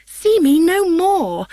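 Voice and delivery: high, slow anguished cry; wearied young woman crying out; cried out. A narrator's reading. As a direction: fling it away from you in distress. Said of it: "cried small dark Hermia".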